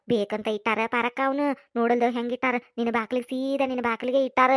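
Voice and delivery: gossiping. Acted. Conspiratorial gossip.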